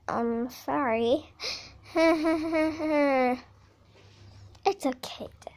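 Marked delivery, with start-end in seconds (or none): 4.7-5.5 whispering. Acted. Quiet reassurance in play.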